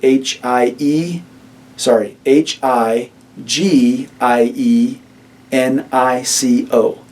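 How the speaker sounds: letters spelled out slowly; slow spelling. Shown as a demonstration. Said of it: "I'll spell that".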